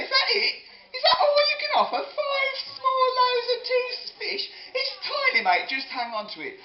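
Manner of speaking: very high, squeaky disbelief; mock scornful Jesus. Mocking the boy's tiny lunch, absurdly high voice. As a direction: sneer in a silly high voice. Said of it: "Jesus didn't sort of look at the little boy and say".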